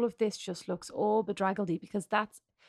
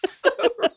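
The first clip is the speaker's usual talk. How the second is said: high shrieking laugh; 9 dB louder than usual. Real, not acted. Both laughing at Mom's hair.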